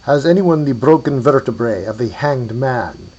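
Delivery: put-on loud man with a Hungarian accent. A narrator's reading. Man seeks vertebrae from afar, Hungarian accent. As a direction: call it out in a stately Hungarian accent. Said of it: "a deep masculine voice with a Hungarian accent".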